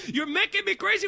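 high-pitched mock complaint; exasperated driver. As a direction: imitate a driver at his wits' end. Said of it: "the guy goes".

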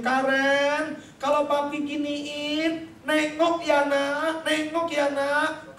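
slow, pleading fatherly call; father calling daughter. Acted. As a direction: call slowly to a child who hardly hears. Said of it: "saya bilang begini".

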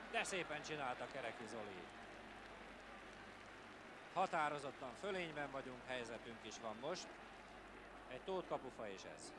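ordinary delivery

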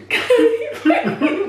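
loud high laugh. Real, not acted. Signing off laughing.

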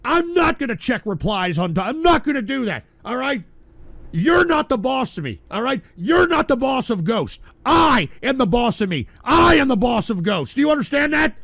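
high, loud yelling at the heckling clip; angry shout. Real, not acted. Streamer yells at the soundboard clip interrupting him, angry shout. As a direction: snap back furiously, then keep hammering it.

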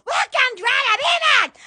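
shouted greeting. Real, not acted. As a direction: belt out the welcome. Calling out to the camera.

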